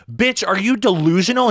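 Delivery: high, loud mock yell at the roommate; retold yell. He yells at his roommate, a loud mock yell. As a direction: shout it back at her like the fight is live.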